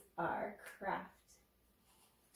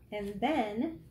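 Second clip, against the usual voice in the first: ordinary delivery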